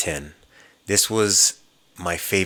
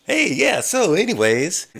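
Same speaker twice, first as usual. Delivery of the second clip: high, louder, breezy mock-casual voice; dazed casual reset; 11 semitones higher, 3 dB louder than usual. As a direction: snap back to normal as if nothing happened. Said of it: "the guy goes".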